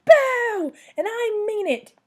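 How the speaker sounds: high, loud, insistent shout. A narrator's reading. Child clown insisting she is scary, shouting. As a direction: shout it like you mean business. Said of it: "I shouted".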